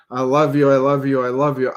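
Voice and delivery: loud, rapid-fire repeated declarations; insistent, hammed-up gushing; mock declaration. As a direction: parody someone just saying the words. Mimicking merely saying the words repeatedly.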